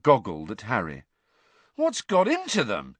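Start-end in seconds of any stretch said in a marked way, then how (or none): 1.7-3.0 higher-pitched baffled demand. A narrator's reading. Ron questions Harry about the girls, demanding. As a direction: ask it in baffled protest. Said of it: "he demanded".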